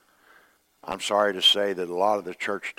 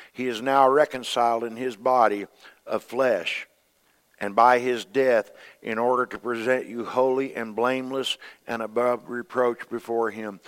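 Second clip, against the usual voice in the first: high, loud preached reading; fiery pulpit scripture reading; preaching scripture; 3 semitones higher, 5 dB louder than usual. Acted. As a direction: proclaim the verse to the church. Reads scripture at full force.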